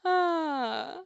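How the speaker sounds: ordinary delivery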